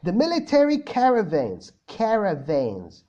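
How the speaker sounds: slow careful American accent. Shown as a demonstration. Teacher reading a viewer's sentence in American accent. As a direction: say "caravans" American style, slowly. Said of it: "قول لي الجملة بالأكسنت ... الأمريكان".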